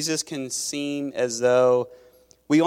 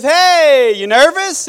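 much higher and louder, a cheery doctor greeting; breezy doctor; 11 semitones higher, 10 dB louder, slower than usual. Acted. Doctor to a patient in the hospital bed, cheery loud greeting. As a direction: sell the doctor's carefree hello. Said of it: "the doctor walks in and says".

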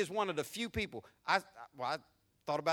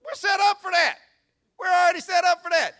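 much higher and louder, smug put-on voice; mock smug boast; 11 semitones higher, 12 dB louder than usual. Preacher lampooning scoffers who think they are ready, loud and smug.

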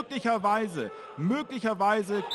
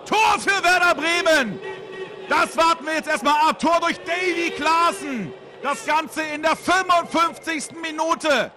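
excited goal call; 7 semitones higher than usual. Real, not acted. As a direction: announce the goal with energy. Live radio goal call.

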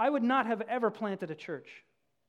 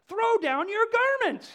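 high, slow, loud indignant cry; donkey demanding homage, loud and high; shouted demand; 10 dB louder, slower than usual. A narrator's reading. Proud donkey shouting at villagers, high and loud. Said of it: "he said".

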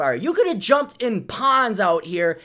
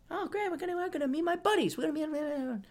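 much higher, soft, eager voice; eager self-quote; 10 semitones higher, 10 dB quieter than usual. Retold or imitated. Retelling going to the bar, high soft eager. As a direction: sound naively excited.

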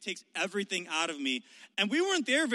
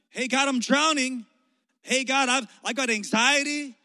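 loud, higher desperate plea to God; pleading cry; 6 semitones higher, 9 dB louder than usual. Retold or imitated. A struggling believer calling to God, loud and pleading. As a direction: cry out for help.

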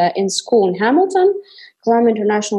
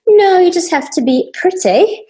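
light British accent imitating teacher; quoting the teacher in a British accent; 10 semitones higher, 4 dB louder than usual. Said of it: "you just did a British accent".

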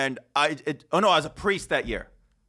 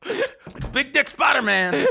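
high, slow Southern drawl with laughter; Southern accent; 10 semitones higher, 4 dB louder, slower than usual. Acted. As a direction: draw it out like an excited Southerner. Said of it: "I gotta get all Southern with it".

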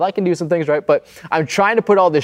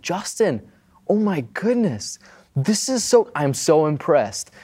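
gushing mom; 3 semitones higher, 4 dB quieter than usual. Retold or imitated. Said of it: "she's like".

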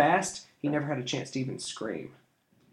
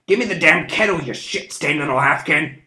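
furious yell; 4 semitones higher, 9 dB louder than usual. A narrator's reading. Thief threatens with dagger.

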